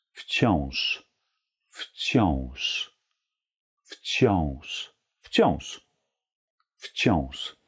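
wciąż ending in a soft sh. Shown as a demonstration. Teacher drills wciąż with soft sh. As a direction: say wciąż gently, not harsh. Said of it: "ein weiches Sch".

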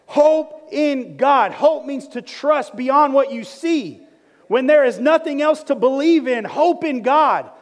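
loud, high, driving sermon delivery; full-force preaching. Real, not acted. Pastor urging the church to hope in God, full-force preaching. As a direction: preach it out with rising conviction.

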